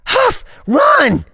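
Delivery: panicked yell to flee; urgent shout. Acted. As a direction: scream the warning at him. Warning friend as monster attacks.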